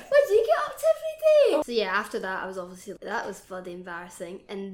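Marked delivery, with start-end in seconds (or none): 0.1-1.6 very high, loud, laughing self-parody; mock clueless small talk. Mocking her own questions to the manager, hammed-up eager. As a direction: squeal it like an overeager fool, hammed up.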